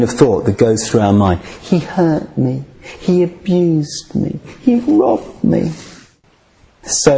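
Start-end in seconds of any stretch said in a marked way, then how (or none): 1.6-5.7 high, slow, whining complaint, rising higher; whiny voice. Shown as a demonstration. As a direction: whine it out, full of self-pity. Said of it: "imagine that with a whiny voice".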